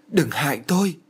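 waking in terror; terrified cry. A narrator's reading. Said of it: "kêu thét lên".

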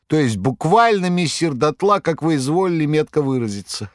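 high, theatrical storyteller voice; a buffoon spinning a breathless yarn, high; Koroviev's tale. A narrator's reading. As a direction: Koroviev dramatically retells the fire to his master.

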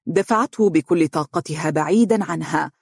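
usual speaking voice

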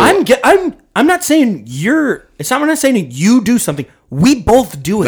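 exasperated, high and loud; worked-up defensive outburst; heated protest. Real, not acted. Heated protest over their banter.

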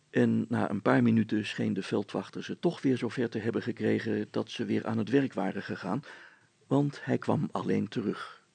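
usual speaking voice

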